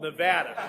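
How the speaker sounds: Nevada with the A of "cat"; local pronunciation. Shown as a demonstration. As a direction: model Nevada as nuh-VAD-uh. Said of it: "we really like it pronounced Nevada".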